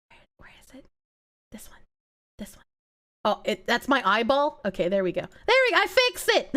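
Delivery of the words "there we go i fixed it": proud cheer. Real, not acted. Proud after fixing the tracker.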